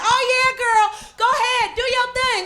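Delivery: high, gushing fake cheerleading; fake encouragement. Women congratulating a girl to her face, fake encouragement. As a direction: hype your friend up in a high put-on voice. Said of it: "women will be like".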